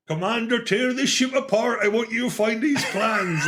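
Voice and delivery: high-pitched, vaguely West Country villain; hammy West Country commander, half pirate; Darth Vader. Acted. Host mimicking film outtakes, villain's orders in a West Country accent. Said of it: "that's my vaguely West Country Darth Vader".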